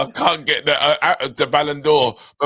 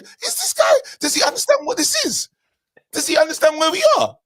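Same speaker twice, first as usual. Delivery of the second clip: incredulous quote; 14 semitones higher than usual. Retold or imitated. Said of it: "I said".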